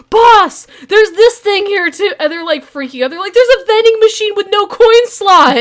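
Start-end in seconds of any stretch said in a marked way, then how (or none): 0.1-2.1 higher, louder panicked report; panicked thug. Retold or imitated. Panicked thugs call boss again. 3.3-5.6 higher and louder mock panic; freaked-out thug. Thugs freaking out on phone.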